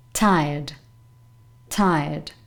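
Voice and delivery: tired with the R left silent; tired as TIE-uhd, falling low; low-pitched careful model of tired, no R. Shown as a demonstration. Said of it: "You don't pronounce this letter here".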